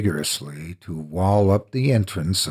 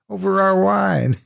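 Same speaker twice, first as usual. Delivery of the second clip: higher, laughing through the words; forced laughter; 12 semitones higher, 4 dB louder than usual. Acted. Walled-in friend pretending the burial is a joke, forced laughter. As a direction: laugh it off, a little unhinged.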